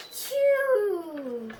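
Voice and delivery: a big acted sneeze; loud fake sneeze. Gerald sneezes loudly in front of Piggie. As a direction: burst out with a sneeze.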